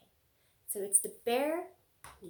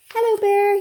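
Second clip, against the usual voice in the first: much higher and louder fox voice; fox's greeting; 10 semitones higher, 16 dB louder than usual. Acted. Fox at the door greeting bear, high and loud. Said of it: "he said".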